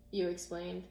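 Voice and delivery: ordinary delivery, nothing marked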